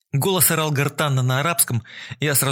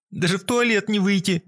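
whimpering; 7 semitones higher than usual. A narrator's reading. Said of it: "горестно всхлипнула дама".

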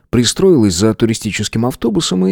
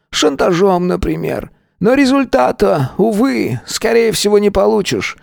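grandfather voice; 10 semitones higher than usual. Acted.

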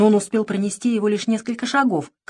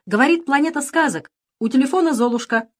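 higher, louder call into the phone; 8 semitones higher, 4 dB louder than usual. A narrator's reading. Queen calls into the pirate phone, higher and louder. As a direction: shout to reach the far cruiser. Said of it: "крикнула".

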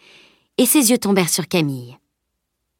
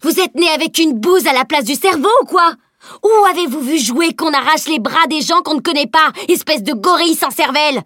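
high, loud tirade of insults; angry explosion; 9 semitones higher, 4 dB louder than usual. A narrator's reading. Girl to the man who hurt her shoulder, angry explosion. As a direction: explode in outrage at the brute. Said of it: "puis explosa".

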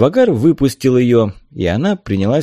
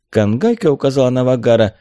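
usual speaking voice

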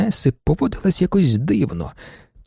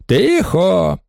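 drawn, raised call for silence; shouted order; 4 semitones higher than usual. A narrator's reading. Judge silences the baroness, with a raised shout. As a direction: call the court to order sharply. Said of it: "крикнув на неї суддя".

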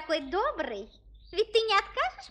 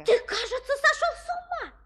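indignant princess; 8 semitones higher than usual. Acted. Princess outraged at the request.

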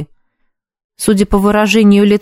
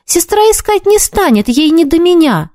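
put-on higher ingratiating boy voice; wheedling; 9 semitones higher than usual. A narrator's reading. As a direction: coax her like a hopeful boy. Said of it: "продолжал он уже заискивающим тоном".

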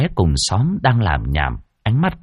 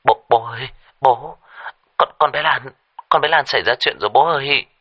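higher, faltering urgent call; hurried, urgent; 5 semitones higher than usual. Acted. Son phoning his father about his sister, urgent. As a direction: stumble over the opening words in alarm. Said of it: "Thằng Long vội vã".

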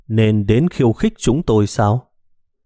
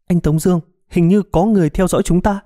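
frightened call; 8 semitones higher than usual. A narrator's reading. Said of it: "sợ hãi hô một tiếng".

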